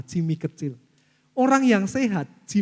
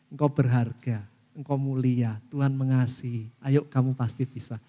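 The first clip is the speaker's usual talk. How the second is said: lower, gentle words of worth; tender affirmation; 6 semitones lower, 3 dB quieter than usual. Acted. Accepting someone unconditionally, lower tender affirmation. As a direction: reassure like a loving father.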